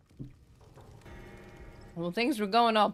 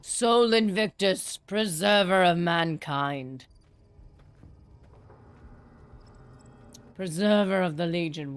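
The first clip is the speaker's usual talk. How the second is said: slow, louder grand proclamation; solemn invocation; 3 semitones lower, 4 dB louder, slower than usual. Pretend. Streamer hails the sun god, slow louder proclamation. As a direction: hail the sun god like a priest.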